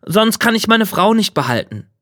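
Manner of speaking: a hint of a youthful hero; young petitioner. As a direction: raise the pitch to plead a little. Young man pleads his case.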